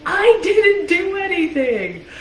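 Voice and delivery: high, loud, slow indignant whine; a child protesting being blamed unfairly; protesting kid. Retold or imitated. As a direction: plead your innocence like a wronged little sister. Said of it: "I'd be like".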